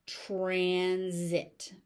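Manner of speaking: "Transit" stretched slowly as tran, zit; slow teaching model of "Transit"; drawn out. Shown as a demonstration. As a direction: hold both syllables of "Transit" long. Teacher stretches word for learners.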